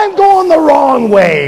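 slow, drawn-out mock wail; pained wail. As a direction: wail it out slowly in mock pain. Acts out pain walking wrong way.

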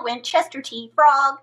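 plain reading voice

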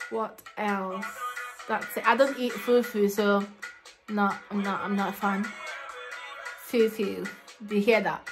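ordinary delivery

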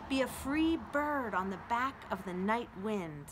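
ordinary delivery

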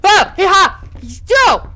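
high, loud yelps of fright; jump-scare yelping; startled yelps. Real, not acted. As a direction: burst out in short startled cries.

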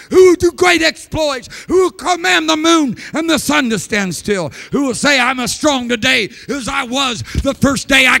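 high, loud, sung-out preaching; preaching at full force. Real, not acted. Preacher thundering God's promise to the congregation, full-force preaching. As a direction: let it ring like a revival pulpit.

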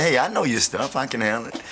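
acted-out youngster making his pitch; eager kid. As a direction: pipe up like a boy volunteering himself. Boy pleads to join father's gig.

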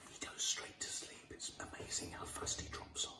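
soft whisper so the puppet can sleep. Real, not acted. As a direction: whisper it, breathy and hushed. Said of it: "we just have to be nice and quiet".

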